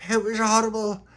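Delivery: high, upset: it was horrible; high dismayed kid voice; upset child's voice. Acted. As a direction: tell it like a frightened kid. Tony retells the horror.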